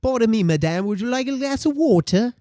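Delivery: hammed-up British accent, lifting in pitch. Shown as a demonstration. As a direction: play a polite waiter with a British accent. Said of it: "Now you're in Britain".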